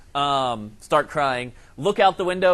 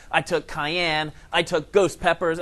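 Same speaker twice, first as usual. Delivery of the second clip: normal talking voice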